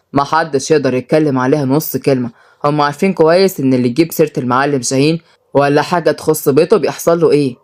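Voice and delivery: low, resolute male figure; stern son. Acted. Son telling mother he won't intervene, stern.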